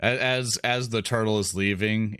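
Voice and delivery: ordinary talk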